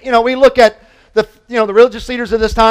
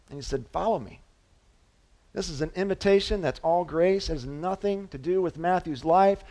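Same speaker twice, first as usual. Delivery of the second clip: much softer, hushed storytelling; hushed reverence; 3 semitones lower, 11 dB quieter than usual. Acted. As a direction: drop to a near-hush to draw them in. Soft hushed preaching passage.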